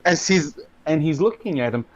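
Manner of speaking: ordinary talk, nothing marked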